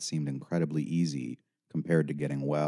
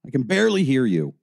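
loud, high-pitched exasperation; impatient raised voice; curt, demanding; 8 semitones higher, 6 dB louder, faster than usual. A narrator's reading. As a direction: complain forcefully into the phone. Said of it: "I was curt and direct".

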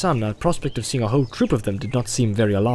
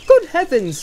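high, loud exclamation of shock; startled, pitched right up; astonished; 7 dB louder than usual. Acted. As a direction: exclaim in lively disbelief. Pippin mock-shocked at Frodo's reply.

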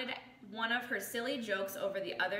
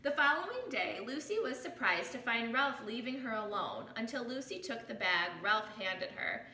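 ordinary delivery, nothing marked

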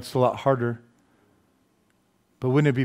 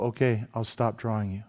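quiet God voice; 4 semitones lower, 8 dB quieter than usual. Retold or imitated.

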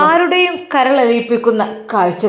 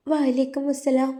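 put-on slow, soft child's greeting; lightly voiced timid girl; shy little girl; 4 semitones higher, 5 dB quieter than usual. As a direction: mumble it like a shy small child. Child shyly returns greeting.

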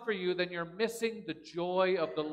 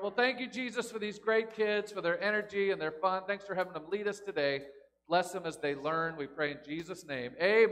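soft, gentle closing prayer; 3 semitones lower, 4 dB quieter than usual. Acted. Pastor praying for the children, soft prayer. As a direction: pray over the kids quietly.

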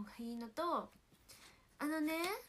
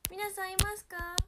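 calling out; 8 semitones higher, 12 dB louder than usual. Real, not acted. Checking if viewers are there.

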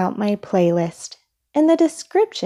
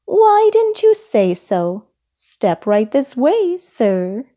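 high, loud start easing down; keen shopkeeper voice; eager salesperson; 5 semitones higher, 4 dB louder than usual. A narrator's reading. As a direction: usher the customer along eagerly.

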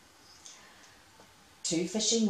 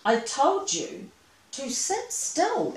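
slow, rising higher scolding; exasperated scolding; 7 semitones higher, 4 dB louder, slower than usual. Acted. Nicholas scolding the mouse who tipped the boat, slow and exasperated. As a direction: scold the careless little friend. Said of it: "exclaimed Nicholas".

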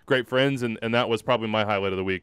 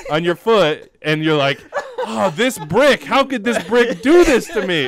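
higher, louder laughing impression of wife mocking him; wife's teasing imitation of him; 7 semitones higher, 5 dB louder than usual. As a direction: mimic wife teasing him about the brick. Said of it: "She's like".

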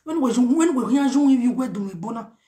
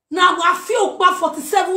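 ordinary delivery

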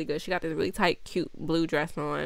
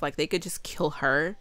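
ordinary talk

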